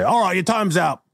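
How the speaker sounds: high put-on jailer's call. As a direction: call out to a prisoner in character. Acted jailer calls out release.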